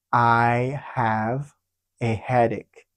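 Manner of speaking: "I have... a headache" slow, paused in the middle; slow phrase-by-phrase model. Shown as a demonstration. Teacher answering how to say it, slow and split. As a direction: separate "I have" from "a headache" unhurriedly.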